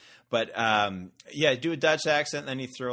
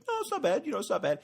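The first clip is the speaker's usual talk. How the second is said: much higher, half-hearted reassurance; mock shrug; 11 semitones higher, faster than usual. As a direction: play it off as merely not bad. Mock unimpressed traveller reply.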